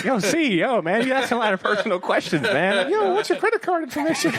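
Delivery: high squeaky prying voice; pry nosily in a squeaky caricature; mocking impersonation. Continues impersonating friend.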